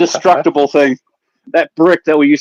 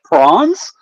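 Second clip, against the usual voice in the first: surprised question; 3 semitones higher than usual. Real, not acted. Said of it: "wait, what?".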